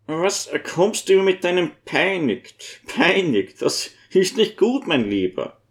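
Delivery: high-pitched, mild scolding; gentle old priest, reproving. Acted. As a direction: chide a friend kindly as an old cleric.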